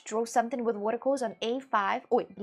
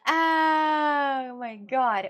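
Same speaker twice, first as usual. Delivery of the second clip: a long, loud, very slow cry of disbelief; real stunned outcry, very slow; drawn-out shout; 3 semitones higher, 7 dB louder, much slower than usual. Real, not acted. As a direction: stretch it out and shout it in shock. Reacting to a game result.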